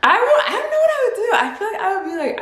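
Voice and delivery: giggling through the words; laughing at the thought of it. Real, not acted. Vlogger imagining herself in the story, giggling.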